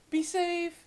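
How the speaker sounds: very high, cutesy send-off; mock farewell. Host seeing off the lost thought, high mock farewell. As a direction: call a playful goodbye way up high.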